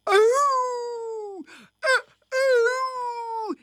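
high, drawn-out howling; dog howl. A narrator's reading. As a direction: imitate a hound howling outside. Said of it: "and howled outside".

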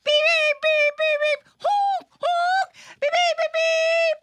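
squeaky honk honk, beep beep; high car horn beeps. Acted. Puppet show for kids, playful high traffic beeps. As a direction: toot like little cars, high and loud.

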